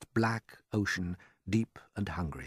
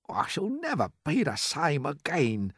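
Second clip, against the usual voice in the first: slow, higher murmur, shaken; murmured earthworm; 7 semitones higher than usual. A narrator's reading. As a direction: mumble it like a rattled worm. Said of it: "murmured the earthworm".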